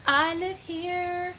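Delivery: very slow, high-pitched, stretched words; drawn-out repeat. Pretend. Streamer answering a viewer who thinks she's on vacation, drawn-out and high. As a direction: stretch each word out high.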